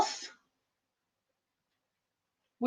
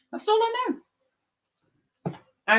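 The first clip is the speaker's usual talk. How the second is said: sing-song; 11 semitones higher, much slower than usual. Real, not acted. Sing-song teasing tag.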